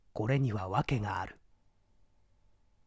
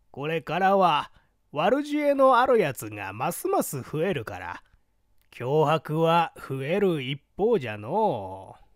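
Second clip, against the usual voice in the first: put-on creaky wise old man; 3 semitones higher, 4 dB louder than usual. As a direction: voice the old man, raised and pinched. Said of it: "老翁は白扇を震わしながら答える".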